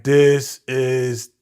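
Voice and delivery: slow, drawn-out disapproval; slow, heavy disgust; dismayed verdict. Retold or imitated. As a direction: drag it out, disgusted. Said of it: "I was like".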